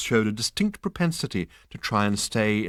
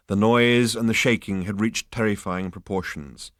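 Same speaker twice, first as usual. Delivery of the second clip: ordinary delivery, nothing marked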